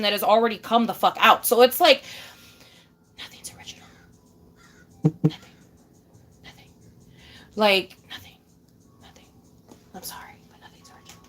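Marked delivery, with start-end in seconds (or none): 3.2-9.2 hushed whisper of the point. Real, not acted. Whispered repeat of her point. 9.9-11.2 quick, hushed whisper; fast secret whisper. Real, not acted. Whispered apology-punchline.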